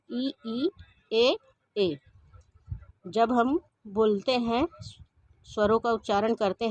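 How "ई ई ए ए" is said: long, drawn-out ई and ए held clearly; teacher sounding out ई and ए for pupils; vowel demo. Shown as a demonstration. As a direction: stretch each vowel ई, ए as a model sound. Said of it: "उदाहरण".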